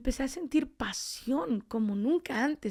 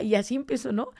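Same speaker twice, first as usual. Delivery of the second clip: usual speaking voice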